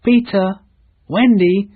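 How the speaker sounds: worried calling. Acted. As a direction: call the lost names across the lake.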